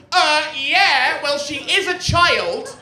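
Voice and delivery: high, loud mocking retort; mock indignant defender. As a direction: imitate an outraged defender. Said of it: "everybody leaps to her defense and goes".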